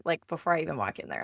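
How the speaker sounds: ordinary delivery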